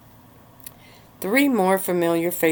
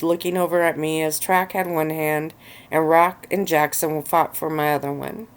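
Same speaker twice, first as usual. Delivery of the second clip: ordinary delivery, nothing marked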